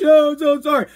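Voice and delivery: high, loud mock whine; whining apology. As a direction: mimic a mother's pleading apology. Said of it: "Whining like".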